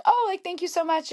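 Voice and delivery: retold thanks in a high, polite voice; high grateful gush. Fan thanking a singer for a compliment, high-voiced. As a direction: lift the pitch right up as you thank.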